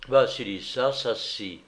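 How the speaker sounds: ordinary delivery, nothing marked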